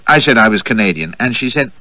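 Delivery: usual speaking voice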